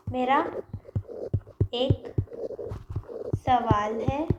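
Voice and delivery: teacher dictating the meaning; word by word. Shown as a demonstration. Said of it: "I have a question".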